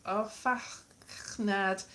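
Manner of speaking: sounding out affachnad slowly, syllable by syllable; halting Welsh attempt. Real, not acted. Learner stumbling over a Welsh word, slow and careful. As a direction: drag out affachnad as if reading an unfamiliar Welsh word. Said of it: "Can't read it".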